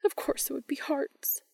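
whispered under the breath, dismayed; muttered whisper. A narrator's reading. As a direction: mutter it like a resigned groan. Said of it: "Jenny muttered".

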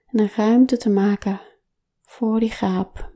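ordinary delivery

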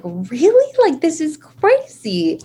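high, slow, drawn-out wonder; mock amazed disbelief. Telling the host how she reacted, in high slow amazement. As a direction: play up the disbelief, lifting high. Amazed at everyone wanting shirts.

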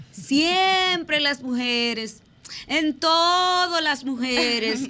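high, loud, stretched-out SIEMPRE and TODO; emphatic exclamation. Real, not acted. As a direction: belt out "siempre" and "todo" on long held vowels. Playful exclamation that draws laughs.

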